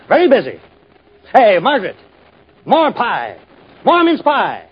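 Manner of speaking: high, loud demands for pie; greedy dream shouts. Acted. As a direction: yell for pie like a spoiled glutton.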